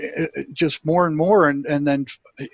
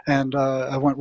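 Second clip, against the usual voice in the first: usual speaking voice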